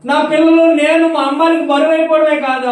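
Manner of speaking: high, loud pleading wail; distressed caller. Retold or imitated. A mother begging the preacher for help, loud anguished wail. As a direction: cry out like a desperate mother.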